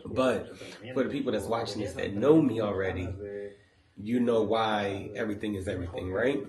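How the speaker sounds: ordinary talk, nothing marked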